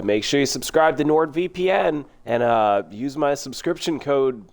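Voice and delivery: hammed-up ad plug, drawn out; mock ad read. Streamer jokingly plugs NordVPN to viewers, mock ad read.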